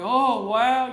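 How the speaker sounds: loud awed crowd gasp of wonder; impressed crowd gasp. Retold or imitated. Preacher imitating an awed audience, loud wonder. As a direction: voice the crowd's loud amazed reaction. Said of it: "uplifted and excited".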